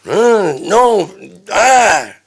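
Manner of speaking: high, loud yells in separate bursts; acted screeching protest; shrieked refusal. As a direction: scream your refusal.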